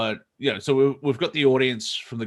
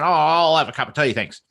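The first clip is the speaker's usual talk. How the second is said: broad Australian accent; 6 semitones higher, 6 dB louder than usual. Shown as a demonstration. Australian intruding among American TV voices, broad Australian accent. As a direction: ham up a broad Aussie accent. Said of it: "you're hearing voices like this".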